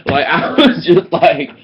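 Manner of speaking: laughing out loud mid-sentence. Real, not acted. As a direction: break into a real laugh while talking. Laughing while retelling comeback.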